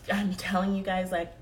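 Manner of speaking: ordinary delivery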